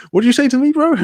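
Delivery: high, loud mock challenge; retold bafflement. Streamer retelling not understanding a Scottish mate, mock bafflement. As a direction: play up confusion into comic outrage. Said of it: "every other word I'd be like".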